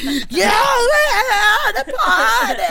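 high, drawn-out party cheer through laughter; hammed-up high party howl; mock party whooping. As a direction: squeal it like a wild reveler. Acting out oiled-up party revellers.

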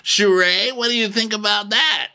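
high, loud hammed-up question; imitated reunion host. Andy turning to Sheree for comment, mock host voice. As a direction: call on the guest with mock flourish.

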